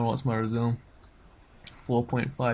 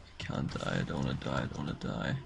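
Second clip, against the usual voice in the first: much lower, softer desperate murmur; mock pleading; 11 semitones lower, 6 dB quieter, faster than usual. Real, not acted. Gamer pleading softly as his warrior nearly dies. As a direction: beg under your breath. Losing fight, near death.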